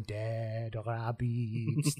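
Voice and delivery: put-on slow, low, heavy French accent. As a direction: draw it out in a thick French accent. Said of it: "in a really strong French accent going".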